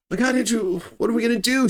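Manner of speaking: high, pleading panicked question; frightened. Retold or imitated. Alexandria asking Andrew for help, high panicked plea. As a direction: beg him for a plan. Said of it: "she says".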